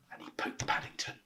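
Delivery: hushed unvoiced narration; storyteller whispering the hush. A narrator's reading. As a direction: whisper so as not to wake him.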